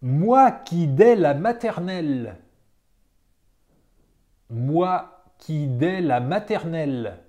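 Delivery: usual speaking voice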